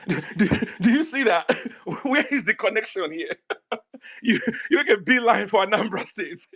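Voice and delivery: talking through laughter, voice lifted; laughing. Real, not acted. Debater ridiculing the other side's leap to Anambra State, laughing. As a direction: laugh incredulously while trying to get the question out. Laughing at rival supporters' reasoning.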